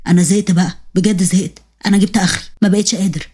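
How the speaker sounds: low, worn-out husband; deep-voiced exhausted husband; fed-up husband. Husband at the end of his rope.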